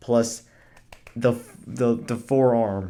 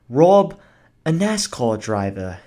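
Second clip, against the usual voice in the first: put-on English accent; 4 dB louder, slower than usual. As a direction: throw in a posh English accent for the punchline. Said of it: "I did that in an English accent".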